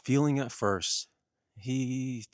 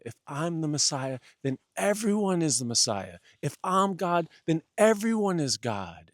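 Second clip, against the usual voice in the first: rising protest; 7 semitones higher, 7 dB louder than usual. Acted. Jesus arguing, growing agitated.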